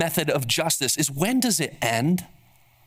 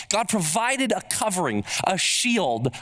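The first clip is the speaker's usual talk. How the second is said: ordinary talk, nothing marked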